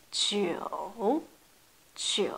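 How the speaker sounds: « Jiu » grave qui plonge; « Jiu » descendant puis remontant; jiu troisième ton. Shown as a demonstration. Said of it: "C'est un peu dur à prononcer". (jiu au troisième ton)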